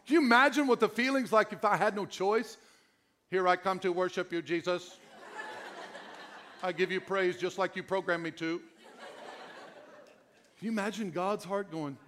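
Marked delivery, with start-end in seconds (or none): 3.3-8.6 programmed worshipper. Pretend.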